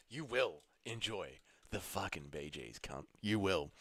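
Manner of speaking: low, slow, hushed mock threat; soft pretend intimidation; mock menace. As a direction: quietly order them, drawing out each word. Mock threat to Bee Gees doubter.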